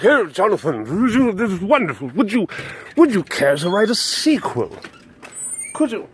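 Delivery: hammed-up publisher gushing. Publishers asking Tolkien for a sequel, publisher voice. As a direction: coax like an eager put-on publisher. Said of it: "that's the publisher voices".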